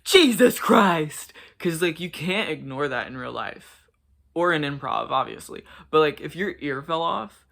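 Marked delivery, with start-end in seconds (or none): 0.0-1.3 high, very loud, drawn-out shriek; imitated scream. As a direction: scream it out slowly in shock, imitating her. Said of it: "just screams".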